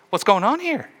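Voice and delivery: a puzzled father calling out; voiced father asking. Father asking the servant what the noise is, puzzled. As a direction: ask it as the surprised father.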